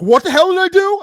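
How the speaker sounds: angry yell. Retold or imitated. Kid yelling at the umpire, outraged shout. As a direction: yell it like a kid caught balking.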